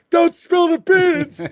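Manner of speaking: high, slow, put-on whiny voice mocking him; mocking Gavin. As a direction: ham it up like the guy who's mad at you. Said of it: "he's the one who said he was done with me".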